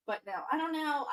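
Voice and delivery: ordinary talk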